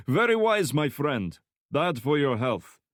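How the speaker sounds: put-on high, loud thick Slavic accent; heavy Slavic accent. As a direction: Slavic thug voice, friendly menace. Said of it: "speaking in a heavy Slavic accent".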